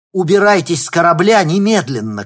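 loud, high urgent yell; shouting. Acted. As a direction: yell the order in alarm. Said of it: "крикнул Никита".

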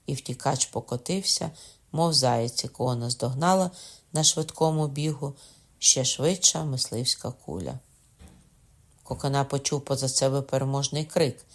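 plain reading voice

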